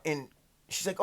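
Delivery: ordinary talk, nothing marked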